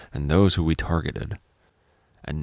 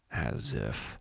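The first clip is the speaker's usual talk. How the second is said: scoffing; 5 semitones lower, 10 dB quieter than usual. Acted.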